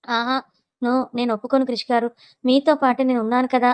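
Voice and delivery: ordinary delivery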